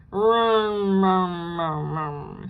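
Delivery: stuck-jaw mumble, fading; muffled mutter. A narrator's reading. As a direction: mumble through stuck jaws. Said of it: "he muttered".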